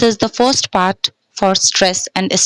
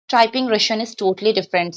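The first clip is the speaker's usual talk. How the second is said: ordinary talk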